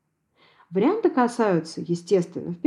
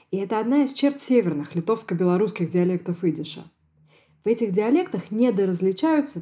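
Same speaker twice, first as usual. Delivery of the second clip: ordinary talk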